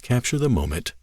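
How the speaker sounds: ordinary delivery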